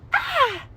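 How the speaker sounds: sudden high shriek of alarm; a startled scream from the crosswalk; dramatic scream. Retold or imitated. Mother screaming as the car creeps forward, a high shriek. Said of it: "the most dramatic scream".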